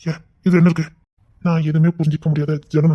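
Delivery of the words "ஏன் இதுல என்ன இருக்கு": ordinary talk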